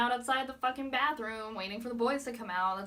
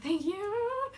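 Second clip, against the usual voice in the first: flustered thanks; 12 semitones higher, 6 dB louder than usual. Retold or imitated. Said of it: "and I was like".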